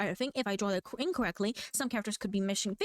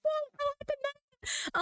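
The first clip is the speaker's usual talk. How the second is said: mock viewer surprise; 14 semitones higher, slower than usual. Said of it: "people are like".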